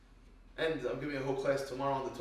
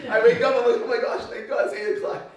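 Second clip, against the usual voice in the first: much louder and higher, excited rush of relief; relieved excitement; 6 semitones higher, 8 dB louder than usual. Retold or imitated. Rabbi retelling his relief to the class, excited and loud. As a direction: burst out like you just escaped.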